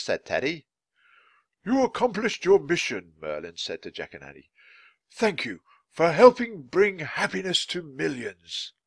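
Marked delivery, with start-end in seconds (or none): 1.6-3.1 higher-pitched, solemn congratulation; a hint of a storybook mentor; lightly voiced wizard. A narrator's reading. Merlin congratulates Jack and Annie, lightly voiced wizard. 5.1-8.7 slow, higher-pitched storybook mentor; grateful wizard. A narrator's reading. Merlin thanks Jack and Annie for their mission, grateful wizard.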